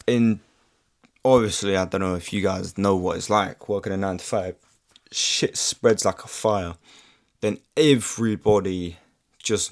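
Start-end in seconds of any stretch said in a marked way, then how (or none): none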